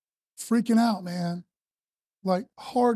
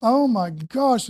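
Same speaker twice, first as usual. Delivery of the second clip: overwhelmed awe; much slower than usual. Retold or imitated. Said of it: "I'm like".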